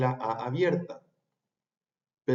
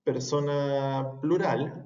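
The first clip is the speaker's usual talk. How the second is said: slow; much slower than usual. Shown as a demonstration. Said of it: "persona singular".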